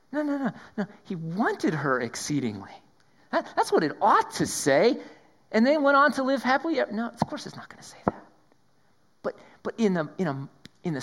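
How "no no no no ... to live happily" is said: mock fairytale rewrite. Mocking the fairytale version.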